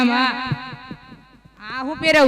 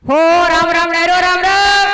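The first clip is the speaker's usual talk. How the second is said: loud, raised call to the crowd; loud greeting; 2 semitones higher, 7 dB louder than usual. Real, not acted. Host greets the dayro audience, loud call. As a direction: hail the whole audience at once.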